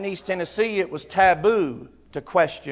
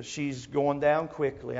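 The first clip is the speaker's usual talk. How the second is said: ordinary talk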